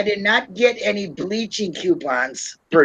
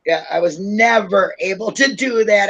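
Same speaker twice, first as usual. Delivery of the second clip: normal talking voice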